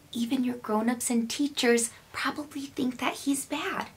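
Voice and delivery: put-on secretive whisper to the kids. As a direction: let them in on a secret, hushed. Secret aside to young viewers.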